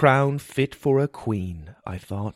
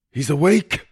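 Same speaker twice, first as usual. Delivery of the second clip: raised, higher exclamation; excited shout; 5 semitones higher, 4 dB louder than usual. A narrator's reading. Healer sees patient wake, excited shout. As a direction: shout the good news. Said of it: "he shouted".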